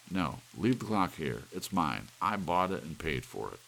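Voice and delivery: angry insistence. Acted. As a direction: claim what is his. Husband claims clock.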